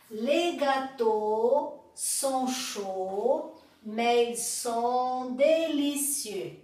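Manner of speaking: very slow, every French syllable drawn out; slow model sentence. Shown as a demonstration. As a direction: spell it out slowly for learners to repeat. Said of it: "Então, répétez, s'il vous plaît". (frase modelo bem lenta)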